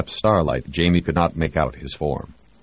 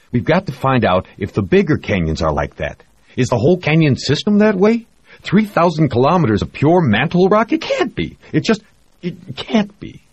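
excited; 11 semitones higher, 3 dB louder than usual. Acted. Jamie excitedly arguing geology.

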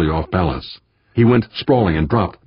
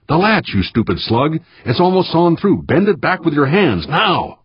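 high-pitched, exasperated scolding; exasperated order; 10 semitones higher than usual. A narrator's reading. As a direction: berate him urgently in a raised pitch. Skipper berating worker over latch.

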